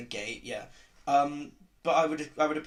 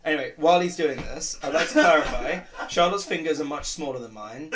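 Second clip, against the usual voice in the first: normal talking voice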